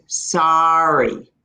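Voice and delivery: "sorry" with an open ah, like "sahry"; slow New York "sorry" with an open a. Shown as a demonstration. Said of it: "The way they would say it in New York".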